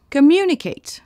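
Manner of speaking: "Communicate" with a high, loud MU; stress on second syllable. Shown as a demonstration. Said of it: "L'accent tonique est sur la deuxième syllabe". (« Communicate » accentué sur la deuxième syllabe)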